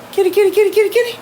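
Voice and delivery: very high, rapid-fire cat call. Real, not acted. Owner calling pet cat Daenerys, high kitty call. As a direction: coax a cat over in a high chant.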